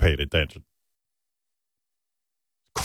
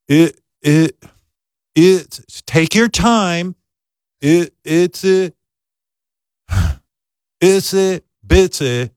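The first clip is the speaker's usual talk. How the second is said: halting, slow, higher stammering reader; mock stumbling reader; 6 semitones higher, much slower than usual. Bully kid reading aloud in class, mock stammer. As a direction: stammer through it like a kid who can't read.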